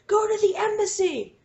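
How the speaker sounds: high-pitched, exasperated mock yell; retold screaming. Viewer screaming advice at the laptop, high mock yell. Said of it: "I'm, like, screaming at their laptop".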